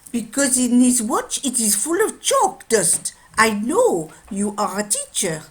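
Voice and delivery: put-on loud, emphatic French-accented father. Watchmaker explaining the chalk dust to a teacher, French accent. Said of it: "a French watchmaker".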